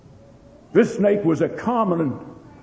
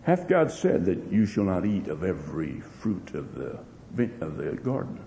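lower, softer, insinuating serpent voice; sly serpent; 8 semitones lower, 7 dB quieter than usual. Acted. Preacher voicing the serpent's question to Eve, low and soft. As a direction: ask slyly, low and soft.

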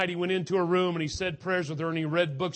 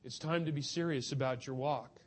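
normal talking voice

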